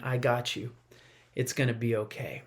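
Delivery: hushed, tender promise; gentle reassurance. Retold or imitated. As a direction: soothe a child close to you.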